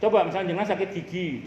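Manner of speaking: usual speaking voice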